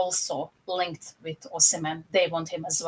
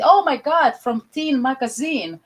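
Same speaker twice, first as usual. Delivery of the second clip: higher and louder, gushing over a new find; hammed-up squeal of wanting a player; mock starstruck buyer; 7 semitones higher, 6 dB louder than usual. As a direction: gush like a kid spotting a crush in a magazine. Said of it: "He sees".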